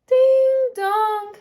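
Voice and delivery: high, loud sung ding dong; doorbell chime. Acted. Teacher reading to children, a loud high doorbell chime.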